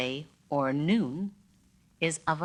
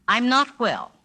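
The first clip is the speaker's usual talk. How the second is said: emphatic complaint; 6 semitones higher, 5 dB louder than usual. Shown as a demonstration. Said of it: "Pehea oi, how are you".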